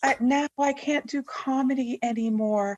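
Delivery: high, slow, mocking whine; mock traumatized whine. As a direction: whimper it in mock distress.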